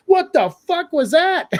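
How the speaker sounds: high, slow, hammed-up Kano yelling in disbelief; Kano's reaction. Kano reacting to a fireball, high and slow. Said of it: "he goes".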